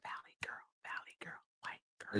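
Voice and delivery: soft unvoiced whisper. Real, not acted. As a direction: breathe the words as a whisper. Whispered suggestion during live.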